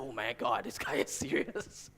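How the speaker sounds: hushed, breathless under-the-breath gasp; shocked whisper. Retold or imitated. New hire at a tense lunch, whispering in shock. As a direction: whisper it like you daren't be heard.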